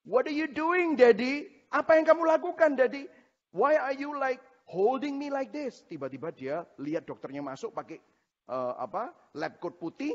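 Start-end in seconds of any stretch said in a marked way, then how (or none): 0.0-5.8 put-on high-pitched toddler voice questioning daddy; acted little-kid voice, pitched well up; two-year-old child. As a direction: imitate a small child pleading with daddy. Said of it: "anak saya umurnya dua setengah tahun".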